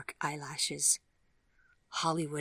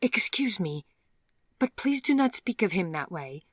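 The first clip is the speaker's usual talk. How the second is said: a touch higher, louder polite protest; Vivian objecting; 5 semitones higher, 6 dB louder than usual. Acted. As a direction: stand up for him, nervous but firm.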